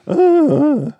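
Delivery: high mock grunting noises, loud; imitating grunts. Podcast host mimics actress's noises, high mock grunts. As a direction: yelp it like she does in the film. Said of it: "she goes".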